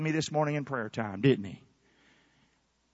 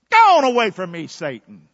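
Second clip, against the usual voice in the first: rebuking shout; 10 dB louder than usual. Pretend. Preacher rebuking Satan, loud then dropping. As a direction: drive the devil off.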